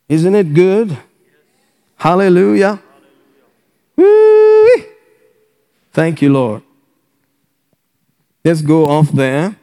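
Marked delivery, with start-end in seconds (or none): 4.0-4.9 high, sustained woo-wee; joyful whoop. Real, not acted. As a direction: whoop with delight on one high note. Praise outburst in sermon.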